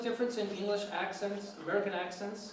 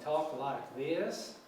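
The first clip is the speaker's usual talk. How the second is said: slow, stretched Texas accent; Texas drawl; 4 dB quieter, much slower than usual. Shown as a demonstration. Lecturer showing students how Texans talk, Texas drawl. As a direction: drag it out like a Texan. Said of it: "You've been to Texas".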